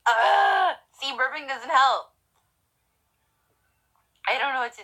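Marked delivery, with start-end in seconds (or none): 0.0-0.7 sudden sharp hiccup, high and loud; a real hiccup bursting out. Real, not acted. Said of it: "I have the hiccups".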